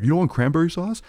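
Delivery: high, fast, insistent hostess voice; pushy relative offering food. Retold or imitated. Relative pushing cranberry sauce on a guest, high insistent voice. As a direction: pester them like a relative with a dish.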